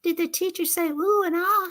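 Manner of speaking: a hint of the nosy puppet; Minnie the puppet. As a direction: puppet asking if the teacher was impressed. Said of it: "I had her to say".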